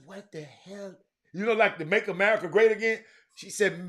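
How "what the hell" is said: soft, muttered disbelief; under the breath, half to self; quiet retold exclamation; mild retold what the hell. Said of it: "and I said".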